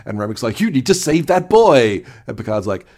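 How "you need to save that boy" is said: loud, high, drawn-out plea; mock outburst. Host as Remick urging Picard, mock outburst. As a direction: cry it out slowly with hammed-up urgency.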